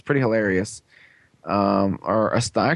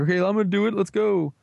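high-pitched put-on eagerness; much higher, playful gung-ho imitation; mock eager hero; 8 semitones higher than usual. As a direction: pipe up like a keen cartoon hero. Hero eagerly joins, acted out.

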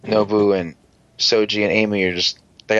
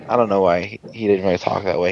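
normal talking voice

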